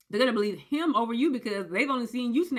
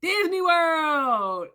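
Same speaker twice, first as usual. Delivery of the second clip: teasing reveal; 6 semitones higher, 5 dB louder, much slower than usual. Retold or imitated. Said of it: "boyfriend announces trip".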